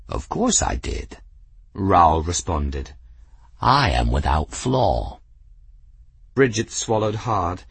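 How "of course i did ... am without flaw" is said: low, lofty cat voice; proud cat. Acted. Cat answering grateful Bridget, low and lofty. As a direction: speak as a cat who knows he's perfect.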